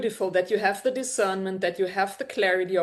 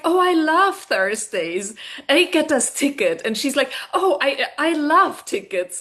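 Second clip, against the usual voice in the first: mock-starry-eyed reply to a date invite; dreamy Natalie; 6 semitones higher, 3 dB louder than usual. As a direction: gush like a smitten airhead, a bit higher.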